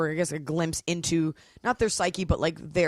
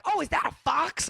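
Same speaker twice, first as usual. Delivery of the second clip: surprised; 11 semitones higher than usual. Retold or imitated. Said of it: "or like".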